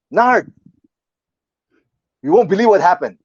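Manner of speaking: high, loud excited call; urgent announcement. Retold or imitated. Friend sitting up in bed calling Nard, urgent. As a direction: imitate a friend bursting with news.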